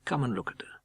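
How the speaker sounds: soft, gentle and hushed; gentle hushed invitation; softly. Acted. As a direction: say it tenderly to a grieving man. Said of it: "I said softly".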